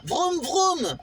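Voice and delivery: mock car engine "vroom, vroom"; playful vroom sound; engine noise. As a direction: make the car roar. Car chase sound effects.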